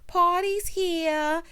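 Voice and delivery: very slow, high drawn-out party call; hammed-up Jersey Shore bro; Jersey Shore partier. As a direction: stretch it like a club bro arriving. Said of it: "He gives me Jersey Shore".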